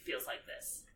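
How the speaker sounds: soft whispered confession. Retold or imitated. Harry answering Hermione in the corridor, whispered. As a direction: breathe it, comforting. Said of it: "he's like".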